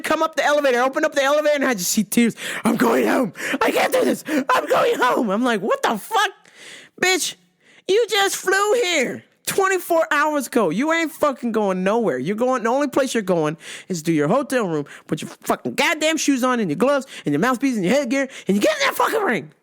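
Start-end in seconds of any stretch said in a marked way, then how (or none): 2.6-5.3 mimicking crying fighter. Fighter crying, wants to go home. 7.0-19.5 higher, fast tough-love rant; mock scolding. Scolding fighter to get in ring.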